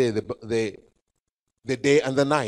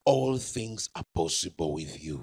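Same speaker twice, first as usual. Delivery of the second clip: low, softened, submissive plea; pleading prayer; 9 semitones lower, 5 dB quieter than usual. Acted. Jesus prays to the Father in Gethsemane, low pleading. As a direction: drop the voice low and soft in prayer. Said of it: "He was pleading with the Father".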